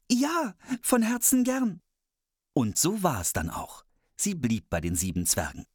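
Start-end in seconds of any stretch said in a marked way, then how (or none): 0.1-1.8 put-on high, slow girlish voice; gladly accepting girl.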